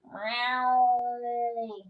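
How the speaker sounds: loud, long falling meow; cat yowl. Pretend. Voicing dirty cat being washed, loud drawn-out yowl.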